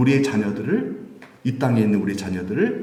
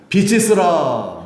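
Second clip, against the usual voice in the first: voice of God; 5 semitones higher, 4 dB louder, slower than usual. Acted. God's creating word quoted in sermon.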